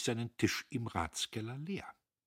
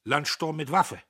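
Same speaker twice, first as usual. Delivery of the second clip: grimmig feixend; 3 semitones higher, 9 dB louder than usual. A narrator's reading. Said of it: "Er feixte grimmig".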